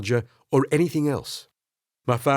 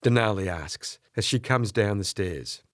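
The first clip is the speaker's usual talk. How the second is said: ordinary delivery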